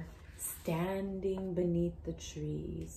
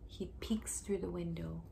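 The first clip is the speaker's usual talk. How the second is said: suspense whisper. Acted. Teacher asking a child in a hushed whisper. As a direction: breathe it out, keep the suspense.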